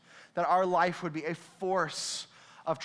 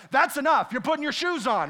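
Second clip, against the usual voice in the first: firm, louder and higher parental order; a parent who's had enough, raised voice; stern parent; 5 semitones higher, 5 dB louder than usual. Retold or imitated. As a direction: lay down the law with the kid. Parent finally snaps at kid.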